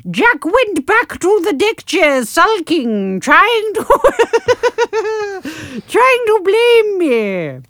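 put-on high, slow, laughing northern accent; overdone broad northern English accent. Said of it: "So I shall do a northern accent".

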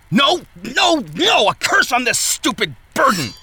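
high, loud repeated no's then a curse; furious outburst. Acted. Smith raging at his burden in the shop. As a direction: rage at the burden, full voice.

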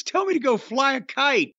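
ordinary talk

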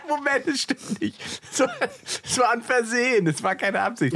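high, laughing denial, caught out; laughing, high-pitched protest at being caught out. Real, not acted. Denies overrunning his set, laughing.

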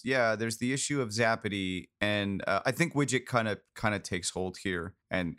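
ordinary talk

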